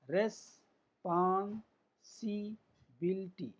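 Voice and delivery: Responsibility slowly, broken into separate beats; teacher's slow long-word demo; syllable by syllable. Shown as a demonstration. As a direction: pause between the parts of responsibility. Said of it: "तीन स्वर से अधिक इनका उच्चारण".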